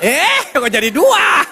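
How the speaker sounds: high, drawn-out startled protest; hammed-up taken-aback outcry; mock shock. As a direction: protest in comic alarm. Acts husband shocked at two items.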